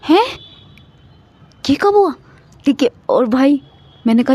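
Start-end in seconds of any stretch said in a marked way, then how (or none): 0.1-2.1 high-pitched retold disbelief; imitating her own stunned reaction; shocked. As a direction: squeak it out like you can't believe it.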